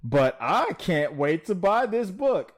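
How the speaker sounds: dripping sarcasm about the book; sarcastic. Acted. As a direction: fake excitement you clearly don't mean. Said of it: "I am lying, of course".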